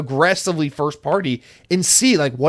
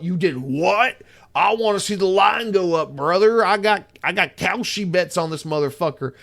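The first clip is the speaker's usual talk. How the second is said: put-on investor bro voice, pitched up in places; line-go-up guy; 2 semitones higher than usual. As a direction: play a worked-up betting investor, hammed up. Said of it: "line go up, man, is gonna be like".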